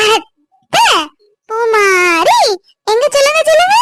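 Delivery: high, eager coaxing; coaxing baby talk. Acted. Aunt urges baby to say aunt, high eager coaxing. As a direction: urge the baby to say it.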